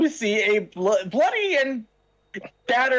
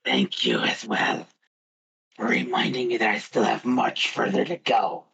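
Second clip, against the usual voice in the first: low, raspy monster growl; growly voice; 11 semitones lower than usual. Acted. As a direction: growl from a torn-up throat. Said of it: "I'm not doing the growly voice".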